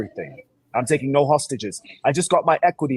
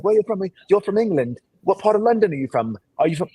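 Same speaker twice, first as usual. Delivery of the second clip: high, louder impression of intrigued strangers; mimicked eager surprise; 9 semitones higher, 5 dB louder than usual. Imitating people who take him for a Londoner, high excited questioning. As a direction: act out curious people firing questions, pitched up. Imitates festivalgoers asking his origin.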